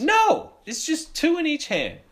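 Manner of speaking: very high mock outburst, then easing in level; insistent dad. Dad juggling juice cups, high mock-insistent outburst. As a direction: blurt a refusal in a high put-on voice.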